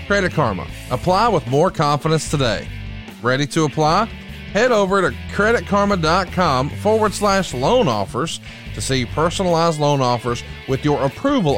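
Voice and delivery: upbeat sponsor read. Acted. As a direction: read it like a radio ad. Podcast ad read.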